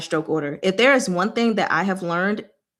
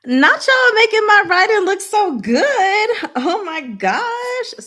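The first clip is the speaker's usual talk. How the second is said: much higher and louder, laughing delight; amused delight; 13 semitones higher, 6 dB louder, slower than usual. Real, not acted. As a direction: squeal at how good it looks, then slow down. Amused at her own neat writing.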